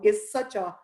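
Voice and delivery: usual speaking voice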